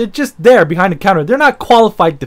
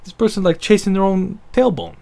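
usual speaking voice

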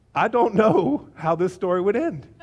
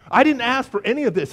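louder, higher exasperated protest; mock protest; 5 semitones higher, 6 dB louder than usual. Jesus protests to Martha about the fuss, emphatic exasperation.

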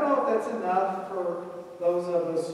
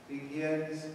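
usual speaking voice

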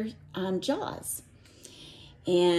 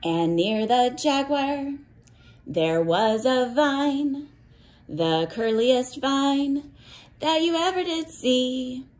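put-on reading to children; 4 semitones higher than usual. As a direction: chant it like a picture book for little ones. Teacher reads cumulative rainforest book.

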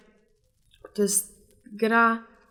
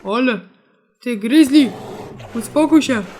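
scolding shout; 6 dB louder than usual. Real, not acted. Yelling at attacking bear.

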